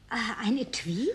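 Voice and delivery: slow, breathless whisper of wonder; hushed surprise over a gift; awed whisper. Acted. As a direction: whisper it as if afraid to spoil it.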